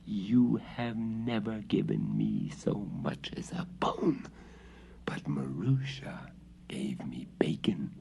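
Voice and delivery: soft hissing whisper of a cat; hissed whisper. Acted. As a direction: whisper it with a hiss. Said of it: "hissed the cat".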